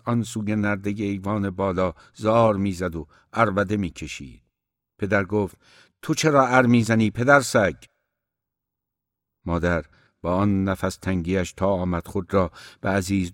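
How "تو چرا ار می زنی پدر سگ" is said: narrated angry scolding, louder and higher; a moderately raised, scolding father's voice. A narrator's reading. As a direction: snap at a bawling child, in a storyteller's voice.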